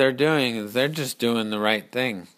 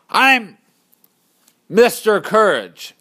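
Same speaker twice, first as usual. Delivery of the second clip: loud, raised, proclaiming the name; bold announcement; 8 semitones higher, 6 dB louder than usual. Acted. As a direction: call it out bravely across the field. Hero announces himself to army.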